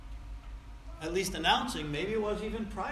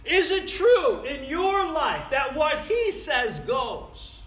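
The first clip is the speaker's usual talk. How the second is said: loud, high, slow and emphatic; forceful preaching challenge; 8 semitones higher, 8 dB louder, slower than usual. Real, not acted. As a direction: ring out each word as a challenge to the congregation. Preacher challenges the congregation directly.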